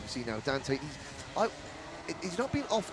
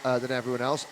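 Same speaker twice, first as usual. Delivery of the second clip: ordinary talk, nothing marked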